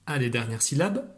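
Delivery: ordinary delivery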